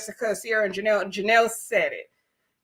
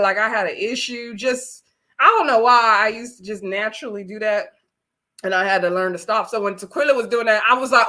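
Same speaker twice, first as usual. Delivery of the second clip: ordinary talk, nothing marked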